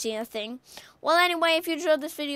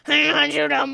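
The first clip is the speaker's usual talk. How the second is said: louder shouted taunt; loud threat; 9 dB louder, faster than usual. Acted. Gamer yelling a threat at the player who shot him. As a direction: yell the threat at the enemy.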